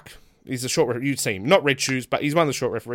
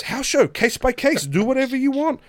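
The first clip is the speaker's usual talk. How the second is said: imitated emphatic brush-off; 14 semitones higher, 7 dB louder than usual. Imitating the booker teasing a stressed wrestler, emphatically dismissive. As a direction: repeat it high and loud, dismissing him.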